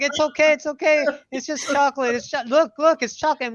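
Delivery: high, loud hammed-up reassurance; a dad telling his scared daughter it's only chocolate; mock soothing dad. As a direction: play the dad calming his daughter over the top. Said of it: "the dad's like".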